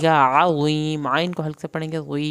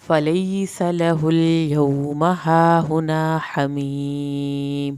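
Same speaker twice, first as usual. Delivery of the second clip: slow, louder recited word; stretched tajweed recitation; Quran recitation; 7 dB louder, much slower than usual. Shown as a demonstration. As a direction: chant it out slowly with a full heavy ṭā. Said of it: "طوا پڑھنا ہے".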